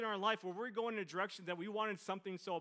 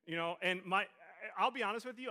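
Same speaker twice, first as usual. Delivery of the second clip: normal talking voice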